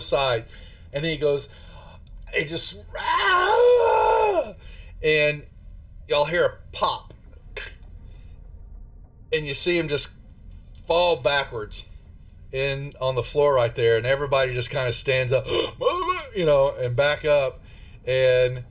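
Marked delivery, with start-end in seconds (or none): 2.3-4.5 a piercing high scream of agony; blood-curdling scream. Acted. Cooper screams as his head gives way, high agonized scream. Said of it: "lets out this blood-curdling scream". 15.5-16.3 sharp gasp. Acted. Crew reacts to horror.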